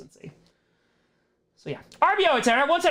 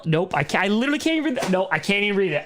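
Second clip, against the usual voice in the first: usual speaking voice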